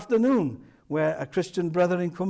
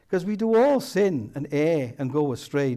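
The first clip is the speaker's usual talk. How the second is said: ordinary delivery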